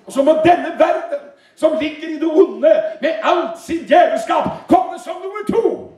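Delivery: high, emphatic preacher's declamation; impassioned high-pitched sermon delivery; full-force preaching. Real, not acted. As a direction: drive each phrase up high to a ringing end.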